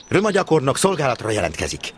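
loud, high, formal report; reporting for duty. Acted. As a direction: snap to attention and announce yourself. (hangos, feszes jelentkezés)

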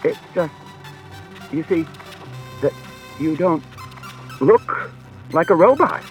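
desperate. A narrator's reading. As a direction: blurt it out, losing composure. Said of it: "said Bailey desperately".

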